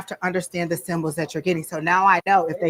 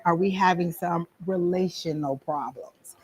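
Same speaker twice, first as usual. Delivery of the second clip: hushed, careful whisper to a sitter; whispered question; 6 dB quieter, slower than usual. Shown as a demonstration. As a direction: whisper it slowly and discreetly. Gently probing a sitter about impotence.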